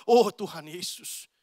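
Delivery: slow, mock emcee voice at prayer. Churchgoer praying like a show host, mildly hammed-up. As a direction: lightly ham up a showy prayer opening. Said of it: "nada suaranya berubah jadi pembawa acara".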